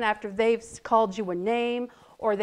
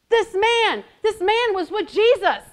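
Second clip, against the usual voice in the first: put-on accusing servant girl; 10 semitones higher, 9 dB louder than usual.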